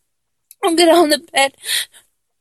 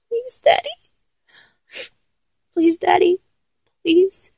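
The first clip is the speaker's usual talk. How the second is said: tearful begging; 6 semitones higher than usual. Acted. Begging the partner not to get dressed and leave, tearful. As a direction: beg through tears for them to stay.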